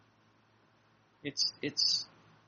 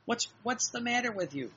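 higher-pitched exasperated reproach; mild scolding; 8 semitones higher than usual. Retold or imitated. Jesus chiding a worried soul, mild scolding. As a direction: chide them in a raised pitch. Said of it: "So he says".

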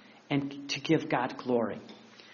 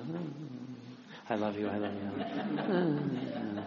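other spouse; 3 semitones lower, 10 dB quieter than usual. Acted. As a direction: answer low and softly, stretching the last one. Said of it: "and the other one says".

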